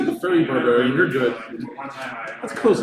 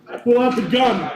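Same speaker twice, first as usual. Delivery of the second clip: excited declaration; 7 semitones higher than usual. Pretend. Player declares action excitedly.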